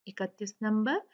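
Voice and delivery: usual speaking voice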